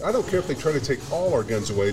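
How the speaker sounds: ordinary delivery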